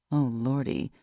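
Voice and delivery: usual speaking voice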